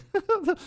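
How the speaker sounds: a quick high chuckle; short high laugh. Real, not acted. Host laughing while switching topics, with a quick chuckle. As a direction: let a brief laugh slip.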